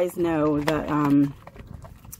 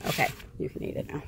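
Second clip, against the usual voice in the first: fast unvoiced whisper; whispered permission to the dog; 4 semitones lower, 10 dB quieter, much faster than usual. Real, not acted. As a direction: quickly whisper the release. Releasing the dog, hushed.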